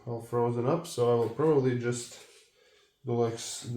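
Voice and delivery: ordinary talk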